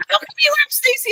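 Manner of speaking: quick, very high mock yell; squeaky mock outrage; retold yelling. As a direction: imitate an indignant, jealous fan. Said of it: "She's yelling at me".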